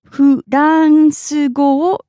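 "Furansu go" very slowly, syllables drawn out; slow repeat. Shown as a demonstration. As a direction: stretch out "Furansu go" for a learner. Teacher repeats phrase slowly.

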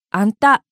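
あんた with the accent on the final た. Shown as a demonstration. Teacher giving the Kansai word for "you", あんた accented on た. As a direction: lift the た of あんた, Kansai style. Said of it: "たにアクセント".

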